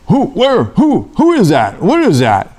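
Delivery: high, halting bewildered questions; startled questioning. Acted. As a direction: stumble over the questions in acted alarm. Acted startled reply to bush.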